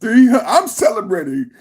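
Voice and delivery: ordinary delivery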